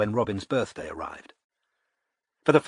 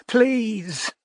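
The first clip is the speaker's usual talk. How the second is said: a desperate cry on the word Please; tipsy woman near wailing down the phone; almost wailing; 11 semitones higher than usual. A narrator's reading. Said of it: "She almost wailed".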